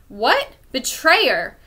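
astonished scream. A narrator's reading. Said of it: "Philip screamed in astonishment".

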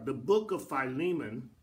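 ordinary talk

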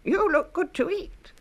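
high, slow bleating lamb; a hungry lamb calling after him. A narrator's reading. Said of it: "baahed the lamb".

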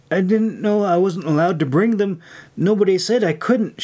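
worried self-defence; defensive. A narrator's reading. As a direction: protest innocently, voice lifted. Recruit defending herself against scolding.

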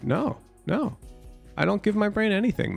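normal talking voice

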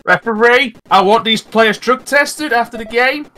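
high, loud mock outrage at the referee; mock referee complaint. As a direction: call out to the ref in mock fury.

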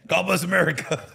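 loud, high hammed-up God bless America; mock patriotic cheer. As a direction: belt it like a proud patriot.

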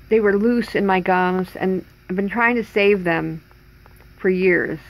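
finger pressed against mouth; talking around fingertip; speaking with finger on lips. Real, not acted. Seen 5: Fingertip pressed on lips/mouth corner while speaking, moves to chin later.

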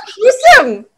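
laughing mock fright. Real, not acted. Learner reacts to grim proverb.

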